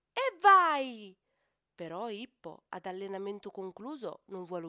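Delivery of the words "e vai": a loud, high whoop as he dives in; shouting. Acted. Hippo calf shouting as he jumps into the pool. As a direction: yell it out with glee. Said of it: "Ippo si tuffa gridando".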